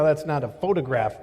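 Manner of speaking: normal talking voice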